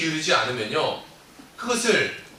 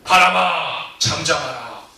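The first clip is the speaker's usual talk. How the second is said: loud ringing command to the wind; commanding shout; 7 dB louder, slower than usual. Acted. Jesus rebuking the wind and waves, commanding. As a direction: order the storm to be still. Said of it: "외칩니다".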